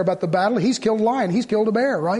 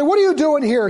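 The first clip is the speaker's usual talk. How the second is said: raised, higher-pitched scolding; mock-angry challenge, pitched up; angry rebuke; 6 semitones higher, 5 dB louder than usual. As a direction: scold like an indignant older brother. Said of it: "got angry and said".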